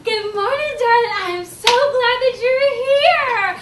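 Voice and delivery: high, loud, slow and cheery; gushing teacher greeting. Acted. Teacher greets crying child at the door, loud gushing cheer. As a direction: welcome a little one with oversized enthusiasm.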